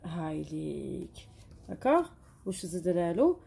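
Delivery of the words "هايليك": slow and softer, drawn out; the teacher's slow softer model word; drawn-out demo. Shown as a demonstration. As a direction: draw the word out gently for the class. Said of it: "ونزيدو له".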